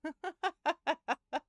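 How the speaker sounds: high, loud staccato laughter. Real, not acted. Storyteller reacting to son's smoke remark, high staccato laugh. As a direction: laugh out in quick, separate bursts.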